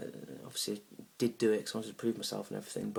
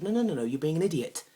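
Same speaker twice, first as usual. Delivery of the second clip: loud, high-pitched exasperated retort; really angry; 7 semitones higher, 7 dB louder than usual. Acted. Man refusing to calm down, angrily insulting his date. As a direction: lose your temper at the date. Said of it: "I was getting really angry".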